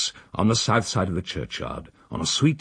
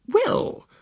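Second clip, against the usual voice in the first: much higher, haughty lady's voice; prim refusal. Acted. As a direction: decline it with sniffy superiority. The female hesitates.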